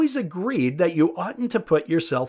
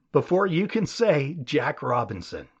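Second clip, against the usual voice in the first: ordinary talk, nothing marked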